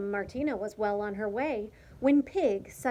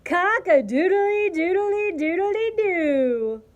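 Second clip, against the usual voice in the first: high, loud sustained cock-a-doodle-doo; long rolling imitated crow; rooster crowing; 7 semitones higher, 9 dB louder, slower than usual. A narrator's reading. Rooster showing off his noise to Martina, crowing loudly. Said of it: "Rooster went".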